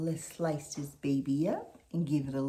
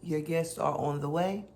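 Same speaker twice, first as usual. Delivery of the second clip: ordinary talk, nothing marked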